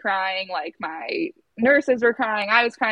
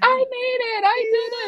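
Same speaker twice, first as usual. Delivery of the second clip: very high-pitched, emotional celebration; retold overjoyed cry; triumphant squeal; 3 dB louder than usual. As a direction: squeal it like you just won. Retold triumph at beating shift change.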